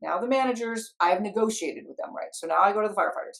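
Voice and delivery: ordinary talk, nothing marked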